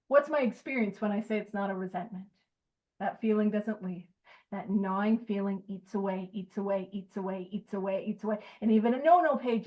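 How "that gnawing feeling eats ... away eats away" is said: each eats away softer and lower; fading repetition. Real, not acted. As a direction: let it die away like something gnawing. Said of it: "repeated phrase fading".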